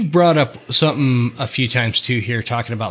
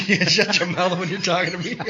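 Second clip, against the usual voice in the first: high-pitched laughing repeat; 7 semitones higher than usual. Retold or imitated. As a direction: giggle through the taunt.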